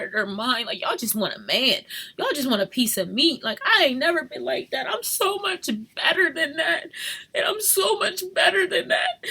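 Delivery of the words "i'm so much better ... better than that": higher, crying laugh; half laughing, half sobbing; laughing through tears. Real, not acted. Mother insisting she deserves better, laughing through tears.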